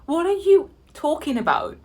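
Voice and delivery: high-pitched, incredulous comeback; exasperated retort. Retold or imitated. Dog owner arguing back at a woman, exasperated. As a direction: throw it back in disbelief.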